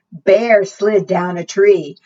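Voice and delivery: usual speaking voice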